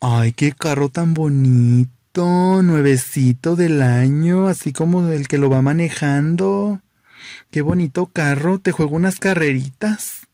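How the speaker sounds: slow, sing-song flirty voice; flamboyant flirty guy. Acted. Flamboyant guy flirting with the driver over his car, slow sing-song. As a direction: drag it out like a flirty show-off. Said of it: "Y le dice".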